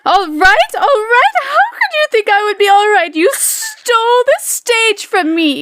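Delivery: high, loud outraged diva; furious diva. Acted. Diva screaming at the understudy who stole her stage, high and loud. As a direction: shriek it at the usurping understudy. La Carlotta confronting the player.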